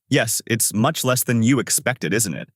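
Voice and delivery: plain reading voice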